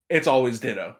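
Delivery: usual speaking voice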